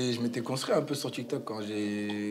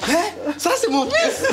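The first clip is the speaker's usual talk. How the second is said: much higher, louder squeal of a proud mum; proud mother; 11 dB louder than usual. Retold or imitated. Mother boasting about her son to the family, high proud squeal. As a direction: shriek it like a mother bursting with pride. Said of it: "Ma daronne, euh, grosse fierté".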